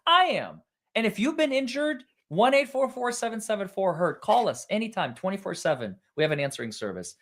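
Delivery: bright, hammed-up ad read; cheesy TV attorney pitch; mock injury-lawyer ad. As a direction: sell it like a late-night lawyer commercial.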